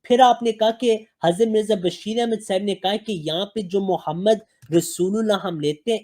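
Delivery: ordinary talk, nothing marked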